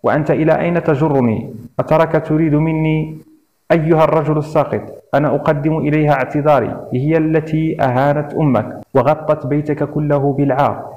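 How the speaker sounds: ordinary delivery, nothing marked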